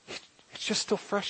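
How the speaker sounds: slow, breathy whisper of wonder; intense whisper. Real, not acted. Preacher to congregation, a slow hushed whisper. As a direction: drop to a hushed whisper to draw them in. Preacher stressing God's fresh call.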